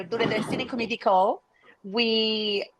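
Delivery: normal talking voice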